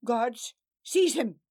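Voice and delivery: loud commanding shout; shouted order. Acted. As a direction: bark the order at guards. Lord ordering guards.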